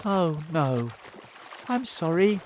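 high-voiced, regretful apology; said sadly. A narrator's reading. James letting his friends down, said sadly. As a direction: apologise with a touch of sorrow. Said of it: "James said sadly".

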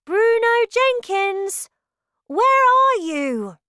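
high, slow call across the hall. Acted. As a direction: call out for a missing friend. Boy calling for lost friend.

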